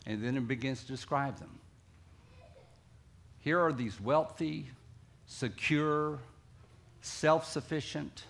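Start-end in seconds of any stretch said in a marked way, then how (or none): none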